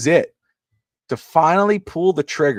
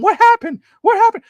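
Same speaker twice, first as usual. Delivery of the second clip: alarmed family; 5 dB louder than usual. Retold or imitated. Wife and mother-in-law run in.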